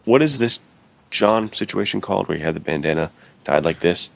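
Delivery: usual speaking voice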